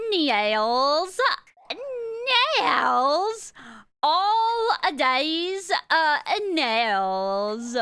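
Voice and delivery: drawn-out cutesy uwu voice, swooping up and down; cringy uwu speak. Shown as a demonstration. Streamer reluctantly performs the cringe for viewers, hammed-up uwu speak. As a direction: stretch each word into a squealy cringe warble. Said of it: "doing the cringy, whingy, woo speaks".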